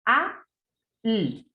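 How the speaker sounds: "A" and "L" said as bare single sounds with pauses, not letter names; isolated sounds. Shown as a demonstration. Teacher sounding out phonemes for the child to blend, separated. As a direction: give each sound alone, slowly. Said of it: "¿qué palabra se forma con estos sonidos?".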